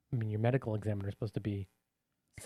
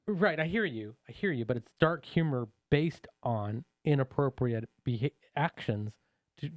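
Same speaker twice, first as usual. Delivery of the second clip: normal talking voice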